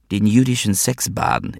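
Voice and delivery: usual speaking voice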